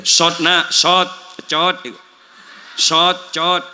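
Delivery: Sot and cot clipped and loud, the way a struggling student says them; student's wrong letter. Retold or imitated. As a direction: mimic a student missing the sound, loudly. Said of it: "cara melafatkan huruf". (menirukan santri salah melafalkan sot, cot)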